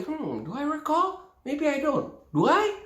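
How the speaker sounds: high, soft musing; musing listener. Retold or imitated. A listener on the phone, musing softly. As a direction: wonder aloud if you remember. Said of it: "the other person on the phone will be".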